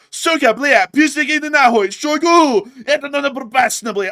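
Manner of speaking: loud, high Russian-sounding rant; mock Russian. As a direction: bark Russian swearing like a performing pet. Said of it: "Russian, say words".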